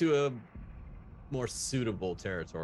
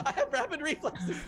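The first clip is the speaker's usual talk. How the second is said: laughing; 5 dB louder than usual. Real, not acted. Joking after group laughter.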